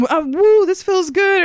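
high, loud hammed-up whoop; mock screaming for attention. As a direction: imitate an over-eager guy in class. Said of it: "he's screaming".

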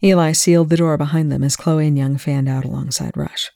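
usual speaking voice

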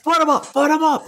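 high crowd-pumping hype man yell; rally the crowd, hyped MC. Retold or imitated. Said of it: "the ultimate fucking hype man".

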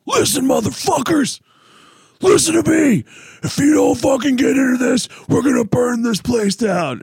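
high, loud mock rock-singer yell at the crowd; retold metal shout. Frontman threatening the festival crowd, loud mock yell. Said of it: "uh, he goes".

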